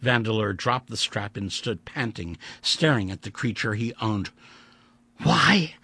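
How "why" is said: pleading demand. A narrator's reading. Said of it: "I asked".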